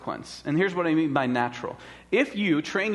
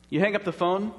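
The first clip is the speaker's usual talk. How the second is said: ordinary talk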